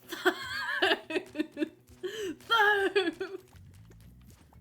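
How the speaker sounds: loud, high wailing laugh, drawn out; mock wailing laugh. Streamer joking about army humour, loud mock wail. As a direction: cackle and wail at high pitch. Hammed-up outburst after the mock cry.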